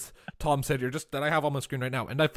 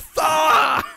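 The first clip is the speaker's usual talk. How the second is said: much higher, louder yelled curse; screamed curse; 12 semitones higher, 9 dB louder than usual. Retold or imitated. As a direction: yell it in drunken frustration. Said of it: "he just screamed".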